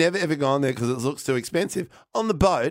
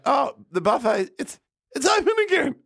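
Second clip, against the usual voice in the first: rising much higher, excited; mock delight; 11 semitones higher than usual. Spotting the buffet open again, high mock delight. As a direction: squeal it like a happy discovery.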